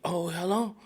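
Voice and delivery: bright, eager high Oh, hello. Retold or imitated. Cassie picking up Nate's call, eager and high. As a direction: answer the phone far too eagerly.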